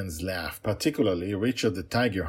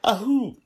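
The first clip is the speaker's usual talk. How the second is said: sneezing; 7 dB louder than usual. Real, not acted. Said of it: "sorry about that".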